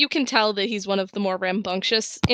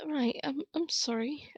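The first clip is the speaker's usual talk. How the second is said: soft sheepish apology; hushed apologetic NPC; whisper; 5 semitones higher, 11 dB quieter than usual. Acted. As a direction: whisper an awkward sorry.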